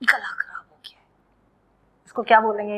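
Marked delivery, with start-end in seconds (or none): 0.0-1.0 hoarse whisper. Pretend. Acts a sore throat.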